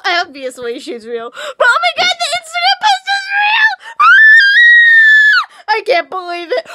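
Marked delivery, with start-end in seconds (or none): 1.3-6.7 very high, loud shrieks; ecstatic shrieking. Real, not acted. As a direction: scream it like a starstruck fan. Fan freaking out.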